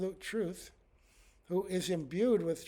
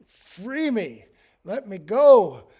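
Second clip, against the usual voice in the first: loud pleading prayer; 3 semitones higher, 15 dB louder, slower than usual. Retold or imitated. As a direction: cry out louder and higher, slowing on let me go. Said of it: "pray to the Lord".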